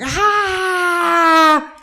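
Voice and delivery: long, very high squeal; hammed-up squeal at a baby; gushing adult squeal. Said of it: "every adult you meet is just like".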